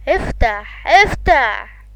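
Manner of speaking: loud, rising demand to open up; yelling open. Real, not acted. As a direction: bark it at the door. Yelling at game character to open. (صراخ بنفاد صبر)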